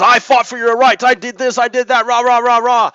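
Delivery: blustery veteran. Retold or imitated. Mocking the veteran's blustery rant at him, loud and high. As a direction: puff up and bluster like an angry veteran. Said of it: "he gets all blustery and says".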